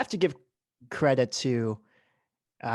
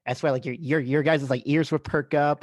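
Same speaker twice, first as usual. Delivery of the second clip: normal talking voice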